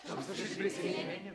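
breathy whisper under the breath; whispered repeat. Acted. Prince repeats the riddle to himself in a whisper. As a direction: whisper it back to yourself.

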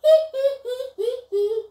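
high, loud hee-hee giggle; pigs giggling. Acted. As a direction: giggle like tiny mocking piglets. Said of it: "laughed the three little pigs".

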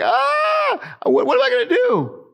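high-pitched wail into hammed-up panic; mock panic. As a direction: cry out as if suddenly helpless, playing it up. Acting out his panic when storms hit.

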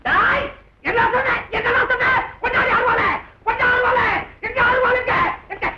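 high-pitched, repeated demands; enraged shouting. Acted. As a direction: explode at the insult. Enraged by the insult. (கொதிக்கும் கோபக் கத்தல்)

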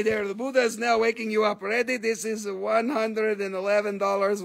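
usual speaking voice

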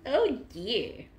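usual speaking voice

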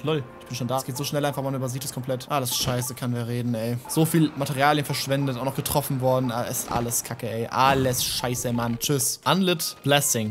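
normal talking voice

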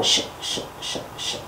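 soft unvoiced sh sh rattling; rattle hiss. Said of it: "I'm going to shake my tail".

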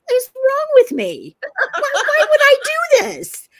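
high, drawn-out comic wail; exasperated self-reproach. Retold or imitated. Woman driving to work berates herself, wailing in exasperation. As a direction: wail at yourself in mock despair.

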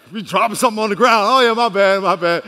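loud, hurried mock apology; sheepish fake excuse. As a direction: cover up with a hasty, comic excuse. Acts feigned excuse to bow down.

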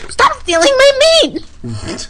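high drawn-out whine; whiny girl voice. Acted.

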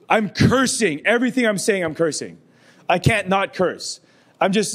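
normal talking voice

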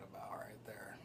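breathy whisper, stress on what. Real, not acted. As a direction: whisper it close and soft. Repeats the phrase, then whispers it.

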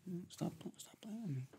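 breathy hushed stop playing, high and soft; a soft, whispered tease; teasing whisper. Real, not acted. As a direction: hush it out like a flirty secret. Teasing partner, voice drops.

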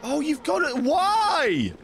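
high-pitched wail of protest; worked-up gamer complaint; exasperated outburst. Real, not acted. Exasperated at the game.

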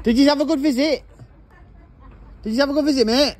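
very high, loud put-on Leeds accent. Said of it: "Leeds accent".

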